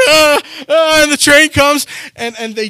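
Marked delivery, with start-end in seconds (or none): 0.1-1.0 high strained mock panic gasps; panicked gasps. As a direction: gasp as the train bears down. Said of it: "he's like".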